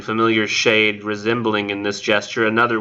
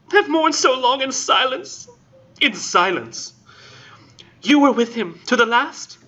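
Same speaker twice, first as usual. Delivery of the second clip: hammed-up grieving woman; 11 semitones higher than usual. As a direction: ham up a lovesick widow's lament. The Intended pleading in falsetto.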